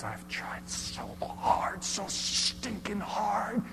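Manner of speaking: tearful whisper, voice cracking; hushed and breaking on so stinking hard; near tears. Acted. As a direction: whisper it as if about to cry. Breaking down at the door.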